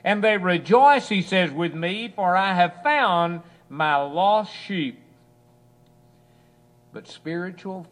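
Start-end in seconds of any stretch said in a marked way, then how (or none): 0.0-5.0 loud, high, ringing sermon cry; loud high preacher's shout, slowing at the end; preaching at full force. Acted. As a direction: lift the whole room with the shepherd's joy.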